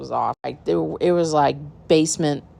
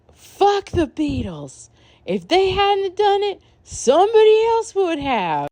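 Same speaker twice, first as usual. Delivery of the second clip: put-on high, slow, drawn-out drunk voice; drunk rant; 4 dB louder, slower than usual. Drunk [name] ranting about the Beatles, high slurred voice. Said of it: "T Y all of a sudden is like".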